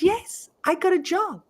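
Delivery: put-on high, insistent kid's excitement; excited. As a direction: re-enact proudly convincing a doubting mum. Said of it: "I was so excited".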